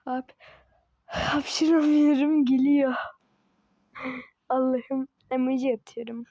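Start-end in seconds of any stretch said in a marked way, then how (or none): 0.0-2.9 fighting back a sneeze; about to sneeze. Real, not acted. Gamer about to sneeze mid-round, strained. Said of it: "Hapşıracağım".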